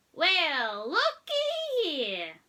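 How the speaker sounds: high, slow, loud country drawl; Mater shouting. A narrator's reading. Tow truck delighted at the tractors, loud slow drawl. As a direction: stretch it out in delight. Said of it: "Mita shouted".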